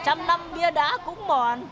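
slow, sing-song recitation; reciting a proverb. Acted. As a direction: lecture the thief with an old saying. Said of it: "Để bà nói cho mà nghe". (đọc ca dao chậm rãi)

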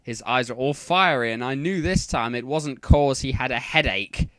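ordinary delivery

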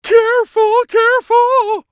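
alarmed hero losing footing; alarmed warning. Acted. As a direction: yelp it as you slip. Nearly falling.

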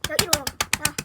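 imitating an annoying boy yelling at her to wake; mock loud pestering; loud nagging. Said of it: "걔는 어떻게 했냐면은".

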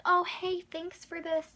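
high, sugary put-on thank-you; butter him up, sing it out high; mock sweet thanks. To her dad, a high mock-sweet thank-you.

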